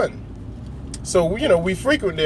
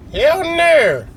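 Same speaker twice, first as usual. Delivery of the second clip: high, loud snap of refusal; sharp retold refusal; 10 semitones higher, 4 dB louder than usual. Best friend shutting down his food question with a loud no. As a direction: fire it back, higher and louder. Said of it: "she was like".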